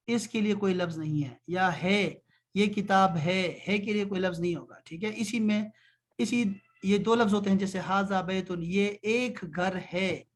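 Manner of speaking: ordinary delivery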